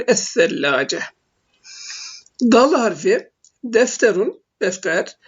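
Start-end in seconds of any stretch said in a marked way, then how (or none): 0.1-1.0 low "Es-sellace" with a doubled lisped th sound; silent lam, doubled se. Shown as a demonstration. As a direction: lower the pitch, hold the double th in "Es-sellace". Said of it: "şeddeli olarak çift okunuyor".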